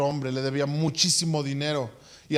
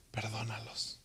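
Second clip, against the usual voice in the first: soft, low, dying plea; Jesus on the cross asking the Father, hushed; 7 semitones lower, 18 dB quieter than usual. Acted. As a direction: barely breathe the word out.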